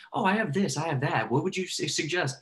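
high, eager rush of mock client questions. Client blurting problems to new coach, high and eager.

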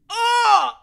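high, loud retching gasp; gag. Real, not acted. Grossing herself out, loud gag.

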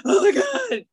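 high, loud mock wail; mock sobbing Trenton. Friend Trenton tearing up at podium, high mock wail. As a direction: ham up a weepy speaker.

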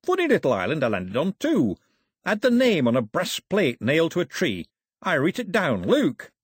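lightly voiced plain-spoken seaman; a hint of a chatty sailor; cheerful old sailor. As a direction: tell your tale like a ship's cook.

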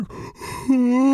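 high, exaggerated intake of breath; shocked gasp. Kid realising brother spat Oreos, horrified gasp. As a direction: gasp big, on a high pitch.

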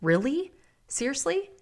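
high, incredulous rising questions; wife scoffing at her husband's question; mock exasperated disbelief. Wife mimicking her own annoyed thought, high and incredulous. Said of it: "her thought about him asking her that is this".

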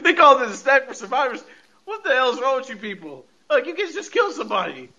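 high-pitched mock outrage through laughter; amused outrage. Podcaster reacting to a deadly snack commercial, amused outrage.

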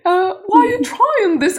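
high, slow imitation of a broad British accent. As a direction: put on a thick British accent. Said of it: "з британським акцентом таким".

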